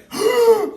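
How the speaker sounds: big, high gasping breath in; loud gasp. Shown as a demonstration. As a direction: show the noisy breath to avoid. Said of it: "it doesn't need to be like".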